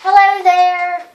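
playing host to the passengers; greeting passengers. Pilot greets passengers on her plane, a light host voice.

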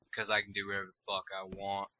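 ordinary talk

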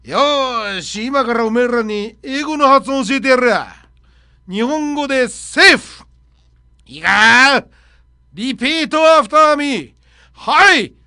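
a coach yelling pronunciation drills at students; karate club teacher. Retold or imitated. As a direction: bellow it like a gruff karate coach teaching English. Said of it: "空手部の顧問もやっている先生が".

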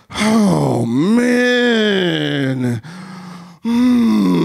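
long, drawn-out groaning sigh; acted sigh. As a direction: stretch the words into one weary sigh. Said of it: "a sigh is like".

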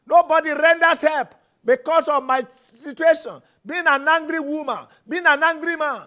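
loud commanding preacher, hammering each get out; a preacher shouting commands at a spirit; fervent deliverance prayer. Acted. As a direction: cast the anger out with full force. Rebuking anger in prayer.

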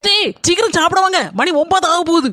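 loud high call to dinner; shouting call. Acted. Daughter-in-law shouting everyone to come eat, loud. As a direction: shout it from the kitchen.

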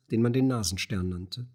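ordinary delivery, nothing marked

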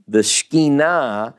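Shekhinah said "shkee-NAH", stress on the end; proper Hebrew pronunciation. Shown as a demonstration. Teacher names what you've heard of, Shekhinah as Hebrew "shkee-NAH". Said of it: "In Hebrew, it's really Shekhinah".

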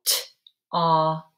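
"T-op" split as T then a loud "op"; "T-op" with a pause after T; sounded out. Shown as a demonstration. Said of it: "the middle sound is different".

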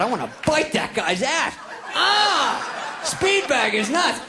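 hammed-up overexcited grandmother; lustful raving grandma. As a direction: build to a mock shriek.

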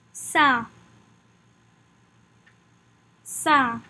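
French "Sa", an open nasal-ended syllable as in "sain"; "Sa" modelled in French for learners; French pronunciation drill. Shown as a demonstration. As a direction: say "Sa" as one isolated French syllable. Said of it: "Practice saying it with me".

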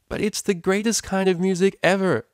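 high, loud outraged enthusiasm; shocked excitement. Acted. Vinyl protesting to Octavia about dubstep, excited. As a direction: defend your favourite music like it's sacred.

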